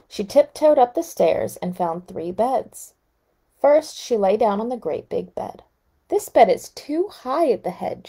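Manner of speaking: calm storybook reading voice; story narration. A narrator's reading. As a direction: read it like a bedtime story. Reading fairy tale aloud.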